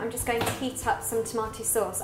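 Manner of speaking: usual speaking voice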